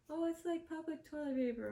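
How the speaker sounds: lightly mock a friend who finds it funny; imitating amused friend. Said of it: "she's just laughing. She's laughing. She's like".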